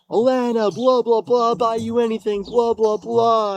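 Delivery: high, babbled mock plea; imitating desperate wheedling; retold begging. Summarizes his own begging to ex.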